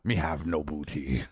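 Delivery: playful pirate growl, then pirate talk. Pretend. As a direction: put on a mock pirate. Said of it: "You sound like a pirate".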